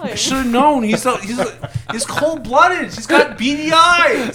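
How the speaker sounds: high, loud hammed-up wail; despairing. Itachi realises the shark boyfriend loves crime, despairing. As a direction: climb into comic heartbroken hysteria.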